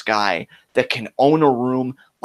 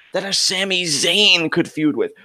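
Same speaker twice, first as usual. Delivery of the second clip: ordinary talk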